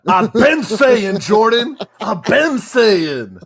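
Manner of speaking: loud, slow, drawn-out insistence; emphatic insistence. Real, not acted. Co-host insists to Jordan he always said it, loud and drawn out. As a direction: stretch every word and shout it, I told you so.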